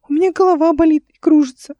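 put-on high, loud plaintive child; high-pitched boyish whimpering complaint; suffering little boy. A narrator's reading. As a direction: complain piteously as a small boy. Dizzy stuck boy complains.

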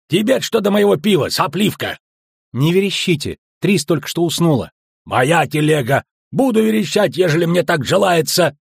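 high, loud indignant bickering; angry squabble. A narrator's reading. Loud squabble between dwarf and girl. (возмущённо, на повышенных тонах)

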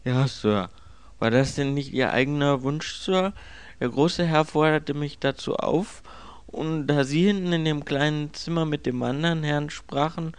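puzzled, dutiful servant's voice; deferential servant. Acted. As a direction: justify yourself politely to the master.

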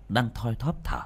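plain reading voice

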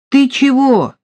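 high, bright surprised question; cheerfully surprised. Acted. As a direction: tease her with mock surprise. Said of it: "весело удивился Марат". (весело удивлённо)

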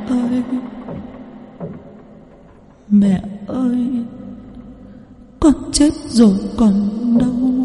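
eerie, slow whimpering spirit voice; ghost's plea. Acted. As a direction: drift it out like a wronged ghost calling her mother. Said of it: "Cô Nở nói là cô bị oan".

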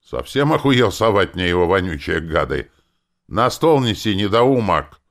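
loud, high, outraged scolding; indignant exclamation. A narrator's reading. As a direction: berate the fool angrily. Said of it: "Тот воскликнул".

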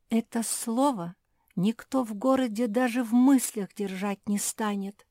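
high, lightly plaintive protest; mock tearful. A narrator's reading. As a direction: lament it with a touch of tears. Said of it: "всхлипнула Вруша".

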